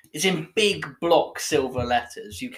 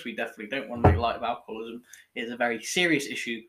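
rushed mock serious; 4 semitones lower, much faster than usual.

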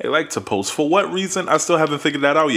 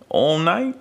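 usual speaking voice

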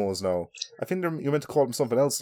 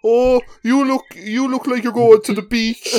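high, loud caricature; hammed-up Eskimo accent, pitched way up; mock Eskimo accent; 10 semitones higher, 6 dB louder than usual. Co-host teasing as an Eskimo, mock accent.

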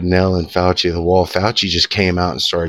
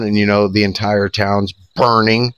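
ordinary talk, nothing marked

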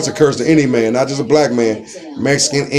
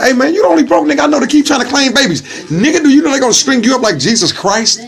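mock scolding; 9 semitones higher, faster than usual. Scolding the brother, retold.

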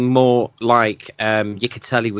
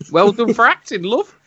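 mock praise pitched higher, dripping with irony; sarcastic praise; 11 semitones higher than usual. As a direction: fake applause for bad acting, tongue in cheek. Mocking the actress's performance.

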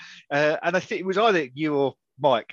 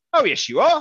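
squawky parrot puppet voice, much higher and louder; 13 semitones higher, 5 dB louder than usual. Retold or imitated. Hand puppet parrot telling barmaid they are with the rugby lot, high puppet squawk. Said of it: "your hand puppet parrot came up and said".

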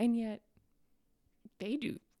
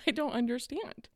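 ordinary talk

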